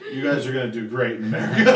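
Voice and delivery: put-on laughing German accent, quick. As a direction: quote Carl through laughter. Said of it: "and he's like".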